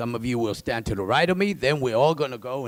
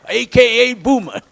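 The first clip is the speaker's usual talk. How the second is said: higher, louder playful announce; playful call; 9 semitones higher, 9 dB louder than usual. Real, not acted. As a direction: tease the nickname out.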